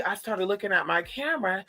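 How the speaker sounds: ordinary talk, nothing marked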